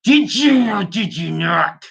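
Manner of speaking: hammed-up interrogation, every word dragged out; mock furious demand. Podcaster demanding an answer from absent politician, mock fury. Repeats the demand more forcefully.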